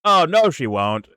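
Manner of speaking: high, loud, slow scoffing denial; dismissive brush-off. Retold or imitated. Mocking Cenk brushing off fans, slow smug scoff. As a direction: wave the worry away smugly. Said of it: "Cenk's dismissive reply retold".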